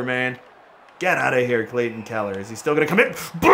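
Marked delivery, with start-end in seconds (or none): 3.4-3.5 high, drawn-out holler; whooping shout. Real, not acted. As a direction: holler it out, pitched way up. Big hit during the game.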